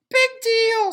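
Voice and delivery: tiny, high, loud tempting voice; pigtail voice. A narrator's reading. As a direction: lure him like a little devil. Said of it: "said the pigtail".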